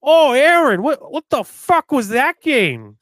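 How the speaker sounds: loud, high Boston hockey fan; old timer ranting in a Boston accent. Retold or imitated. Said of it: "this guy walks in here and he's like".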